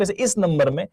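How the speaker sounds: ordinary talk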